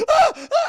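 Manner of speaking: high, loud startled yelps; two sharp acted shrieks; short mock screams. Captive grabbed by captor, high mock yelps.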